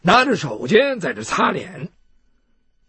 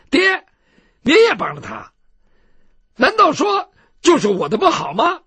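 high-pitched daughter objecting to her father; aggrieved daughter, pitched up; protesting daughter; 7 semitones higher, 4 dB louder than usual. Acted. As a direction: protest to dad in a higher voice.

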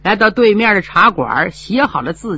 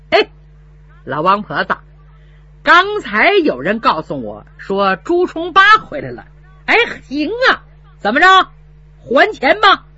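sneering steward; 5 semitones higher than usual. Acted. Bully steward demands money.

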